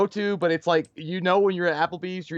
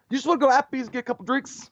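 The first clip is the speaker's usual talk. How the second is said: fast, higher mock buddy invite; quick invite; 6 semitones higher, much faster than usual. Mike inviting him for drinks, fast higher voice. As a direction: rattle it off casually.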